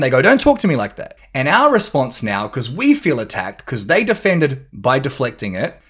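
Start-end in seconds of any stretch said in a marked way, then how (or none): 0.2-1.2 high, loud mock protest; defensive. Partner reacting to an attack, acted defensively. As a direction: push back as if attacked. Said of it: "So when they defend".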